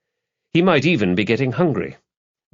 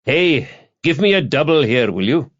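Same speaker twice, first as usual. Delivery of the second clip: raised, higher-pitched call for service; calling the barman; 7 semitones higher, 4 dB louder than usual. A narrator's reading. Stevens hails the barman for a double, calling out. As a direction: call across the bar for a drink.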